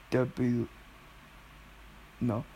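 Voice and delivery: normal talking voice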